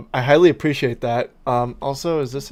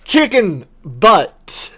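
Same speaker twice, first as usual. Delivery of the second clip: slow, loud and higher, sing-song; drawn-out joke shout; 6 semitones higher, 9 dB louder, much slower than usual. Pretend. Gamer answers guess what with the old joke. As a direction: stretch it out like a playground taunt.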